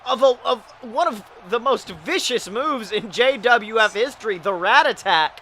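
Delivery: excited ringside play-by-play; wrestling commentator. Acted. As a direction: call the match live, hyped.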